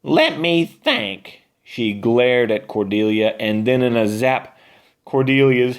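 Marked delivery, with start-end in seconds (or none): 0.0-1.4 higher, slow, scheming witch voice; sly witch. Acted. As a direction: drag it out like a gloating witch. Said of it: "Beulah snorted and cackled".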